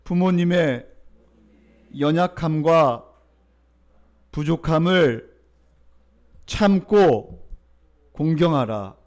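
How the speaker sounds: loud, slow call-and-response recitation; congregation repeat. Shown as a demonstration. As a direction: lead the church in repeating it. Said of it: "따라할까요?".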